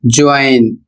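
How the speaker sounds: ज्वाइन said as "join", with an OY glide in the middle; ज्वाइन as a classroom example word; careful English pronunciation. Shown as a demonstration. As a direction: model ज्वाइन with a clear OY glide. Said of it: "वह हो जाएगा".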